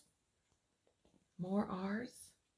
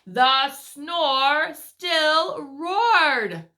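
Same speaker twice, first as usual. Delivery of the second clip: story narration; 4 semitones higher, 11 dB louder, much slower than usual. A narrator's reading. Reads picture book aloud.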